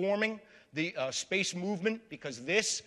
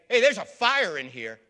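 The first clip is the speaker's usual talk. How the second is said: louder, urgent call of alarm; retold alarm call; 9 dB louder than usual. Lecturer recreating the astronaut's last radio call, loud alarm. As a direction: shout out the warning like the astronaut did. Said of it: "suddenly you hear him say".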